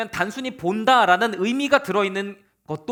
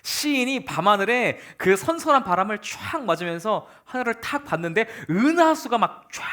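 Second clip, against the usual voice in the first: normal talking voice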